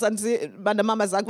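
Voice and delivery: normal talking voice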